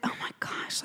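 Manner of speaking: whispered oh my gosh; under her breath. Retold or imitated. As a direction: breathe it out, barely audible. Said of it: "under my breath".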